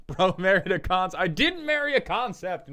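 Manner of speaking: laughing, high, loud protest; indignant outburst. Real, not acted. Streamer objecting to being told he married a concept, indignant. Indignant retort to chat's teasing.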